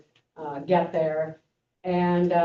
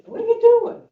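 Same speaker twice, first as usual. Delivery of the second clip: high, loud, startled cry of what are you doing; startled question; 9 dB louder than usual. Retold or imitated. Monk asking his teacher, startled, in a high loud cry. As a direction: burst out in shock at the teacher.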